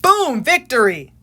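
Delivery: hammed-up victory shout; victory cheer. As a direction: declare the win with a flourish.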